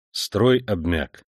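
ordinary delivery, nothing marked

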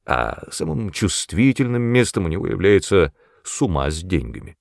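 ordinary delivery

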